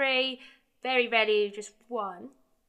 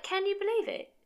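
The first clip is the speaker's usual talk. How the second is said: much higher, excited can-you-believe-it; disbelieving excitement; 10 semitones higher than usual. Retold or imitated. Telling her friend about the Puma offer, high excited disbelief. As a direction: squeal it out in disbelief.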